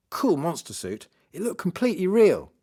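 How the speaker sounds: put-on impressed boy. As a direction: gush like a delighted young friend. Boy raves about the monster suit.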